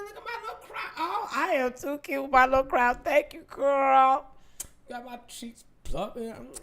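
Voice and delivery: high, squealy cute voice; cutesy preening. Real, not acted. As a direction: coo over yourself in the crown. Admiring a filter crown.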